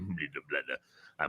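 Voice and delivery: very low, soft rumbling babble; imitated truck engine. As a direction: chug along like a big truck idling.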